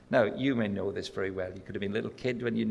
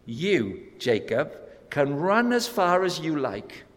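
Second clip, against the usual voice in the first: God calling after Jacob, raised and emphatic; God's insistent call; 7 semitones higher, 6 dB louder than usual. Acted. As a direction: proclaim it like an unshakeable promise. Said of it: "God is saying to him".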